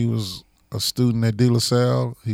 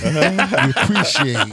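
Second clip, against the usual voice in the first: slow and dragged out; slow, drawn-out; 4 semitones higher, slower than usual. Shown as a demonstration. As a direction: stretch "We appreciate" out slowly, word by word. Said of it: "say the word again".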